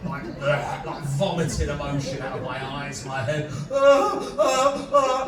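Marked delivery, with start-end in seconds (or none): 3.7-5.2 loud, high mock sobbing gasps. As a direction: gulp out sobs in big heaving bursts. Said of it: "like that".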